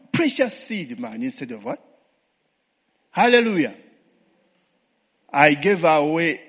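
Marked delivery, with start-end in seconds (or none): 3.1-3.8 loud, high call of praise; shouted hallelujah. Real, not acted. Preacher shouting praise to the congregation, loud call. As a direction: throw the hallelujah out to the room.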